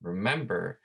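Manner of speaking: normal talking voice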